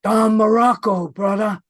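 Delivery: loud, high Snuka catchphrase, brother; Snuka impression. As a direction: bellow the name like a hyped wrestler.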